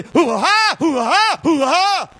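high, loud hammed-up hoo-ha call; mock a stranger hollering for sheep; mock stranger's call. Preacher mocking a stranger calling sheep, hammed-up high hoo-ha. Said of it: "you could go out there and say".

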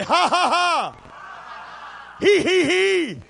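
high, drawn-out pulpit laugh; demonstrated laughter. Pretend. Preacher showing the church psalm laughter, demonstrated laughter. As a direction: belt out each laugh syllable for effect. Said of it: "tongues filled with laughter".

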